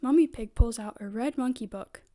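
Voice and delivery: usual speaking voice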